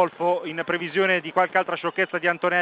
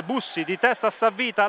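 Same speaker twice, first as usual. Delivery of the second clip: ordinary talk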